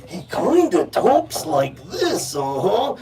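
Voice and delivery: slow pro-wrestler impression; Macho Man Randy Savage. Showing the crowd how an old pro wrestler talks, wrestler impression. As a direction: drag it out like a wrestler, pitch swooping. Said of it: "try to talk in the voice".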